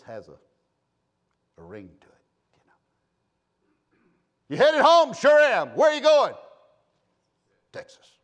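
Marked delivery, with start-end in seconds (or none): 4.5-6.3 high, loud, punchy questioning; booming back-and-forth banter; hearty acted exchange. Acted exchange between travellers. 7.7-8.2 very soft, understated reply; hushed, proud understatement; savoured answer. Acted. Acted proud answer to question.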